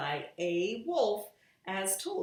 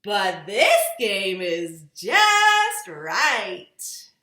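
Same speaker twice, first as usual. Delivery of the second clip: character voice; 13 dB louder, much slower than usual. A narrator's reading. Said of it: "said somebody".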